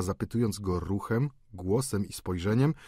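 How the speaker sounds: plain reading voice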